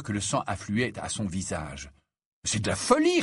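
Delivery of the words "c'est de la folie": high, incredulous outburst; exasperated retort. A narrator's reading. Harry retorts to his raging friend, exasperated. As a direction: throw it back in exasperation. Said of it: "répliqua Harry".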